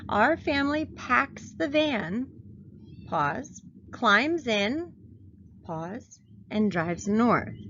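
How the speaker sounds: slow on and drives north; calling out each pause. Shown as a demonstration. Teacher showing phrases in a series, saying pause at commas. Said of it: "My pauses, boys and girls, are going to be the commas".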